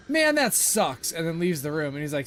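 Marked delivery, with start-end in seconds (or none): 0.1-1.1 put-on high, slow, drawn-out child's letdown; kid's disappointed whine. Son told no juice box, whining his disappointment high and slow. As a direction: stretch it like a sulking little kid. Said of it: "And his kid is like".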